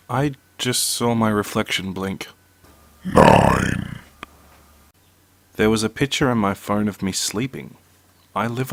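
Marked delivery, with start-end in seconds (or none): 3.0-4.0 very low, drawn-out monstrous announcer; spooky countdown voice. Acted. Narrator announces the next creepy story, deep spooky countdown. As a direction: drop deep and stretch the number out.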